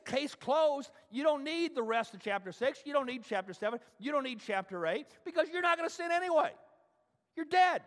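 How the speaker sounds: high, loud mock certainty; mock triumphant. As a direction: ham up the easy way out. Preacher mocks a lax objection.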